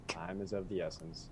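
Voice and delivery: soft, low-key reminder; quiet, understated in-character grumble; muttered aside. Acted. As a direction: say it under your breath to those beside you. Player voices impatient waiting companion.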